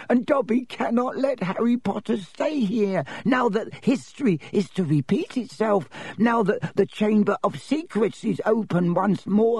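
high, fervent elf oration; impassioned little house elf, rising to dread; Dobby the elf, fervent. Acted. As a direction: declaim it in a high-pitched servile voice. Narrator voices Dobby the elf.

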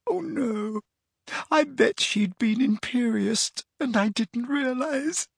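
put-on a crying girl's voice breaking into sobs; tearful. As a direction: sob out the whole confession. Leanne crying throughout.

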